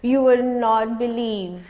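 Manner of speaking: laughing, insistent encouragement; eager urging. Real, not acted. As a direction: push them to do it now with a laugh. Urging people to try therapy.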